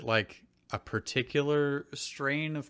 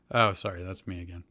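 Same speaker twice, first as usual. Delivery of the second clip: ordinary talk, nothing marked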